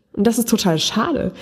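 usual speaking voice

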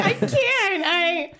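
high, squeaky mock lovesick woman; lovesick protest. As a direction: play a hopelessly smitten friend, very high. Voicing lovesick Mary Jane replying.

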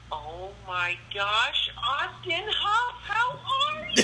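very high, slow, stretched-out mock shriek; overjoyed girl squeal. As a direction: draw out every word, building to a squeal. Imitating Jenny's sudden fake recognition.

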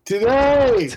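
high, drawn-out concert heckle; retold yell. Recalling heckling the band from the back, mock yell. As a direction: holler it from the back of the crowd. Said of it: "kept yelling".

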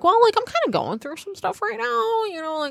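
pitched high, pleading; high plea to the boyfriend who is breaking up with her; high exasperated plea. Retold or imitated. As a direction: plead your case, exasperated. Defending herself to boyfriend.

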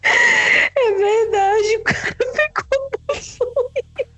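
high, slow, crying with laughter; after laughing hard. Real, not acted. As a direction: squeeze it out through helpless laughter. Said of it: "tá doendo de tanto rir".

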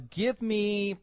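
usual speaking voice

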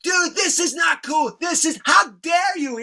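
high-pitched, drawn-out indignant scolding; Kirk, pissed. Retold or imitated. Woken homeowner telling off the noisy band, pissed. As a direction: imitate an outraged homeowner telling off intruders. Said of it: "He's pissed".

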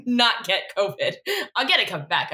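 usual speaking voice

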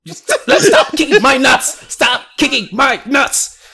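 high, loud yelp of a kicked villain, half laughing; mock-pained high shout. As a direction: yell it while laughing, begging them to stop.

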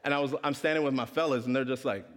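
usual speaking voice

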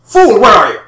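loud, high, furious shouting; angry yelling. Acted. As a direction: yell for the scout, enraged. Villain yells for the scout.

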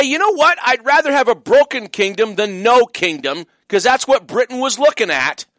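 ordinary talk, nothing marked

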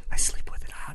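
hushed, whispered aside; softly whispered joking admission; whispered confession. Real, not acted. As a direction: whisper it like a secret. Joking confession under the others' talk.

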